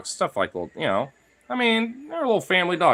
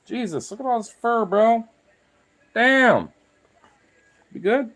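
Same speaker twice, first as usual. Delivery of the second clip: much higher, chummy pet talk; talking to the dog; 12 semitones higher than usual. Real, not acted. Owner talks to his shedding dog while petting him, much higher pet talk. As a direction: coo at the furry dog like a buddy.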